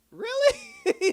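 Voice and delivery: squeaky-high, loud disbelief; incredulous. Retold or imitated. Viewer reacting to "we're both in it now", incredulous. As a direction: shoot the voice way up in mock astonishment.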